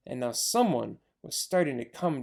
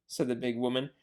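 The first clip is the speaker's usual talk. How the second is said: usual speaking voice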